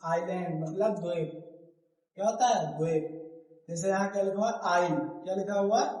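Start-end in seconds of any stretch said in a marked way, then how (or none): none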